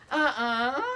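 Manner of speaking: high, loud refusal to believe; squealed, hammed-up denial; mock disbelief. Actor learns the girl is [name], squealed mock disbelief. Astonished reaction to learning who she is.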